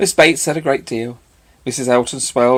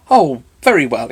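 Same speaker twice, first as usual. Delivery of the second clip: louder bright exclamation; eager exclamation; exclaiming; 2 semitones higher, 7 dB louder than usual. Acted. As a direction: burst out cheerfully. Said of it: "exclaimed Missus Bates".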